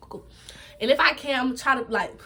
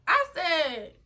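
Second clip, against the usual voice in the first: ordinary talk, nothing marked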